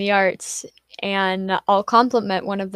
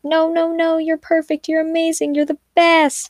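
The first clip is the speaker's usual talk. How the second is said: much higher, gushing over-the-top reassurance; high gushing praise; 9 semitones higher than usual. Retold or imitated. Everybody gushing at the modest artist, high and fawning. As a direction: pile on the compliments in a high, fawning voice. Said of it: "And everybody's like".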